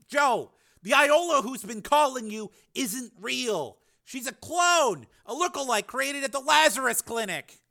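urgent persuasion. A narrator's reading. Frank explaining the clone, urgent persuasion. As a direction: spell it out urgently.

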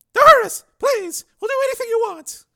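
put-on very high, loud pleading girl; terrified girl. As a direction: shriek in terror.

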